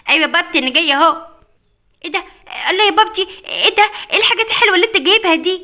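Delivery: high, loud, overjoyed; excited squeal. Acted. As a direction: burst out with delight at the presents. Child sees gifts.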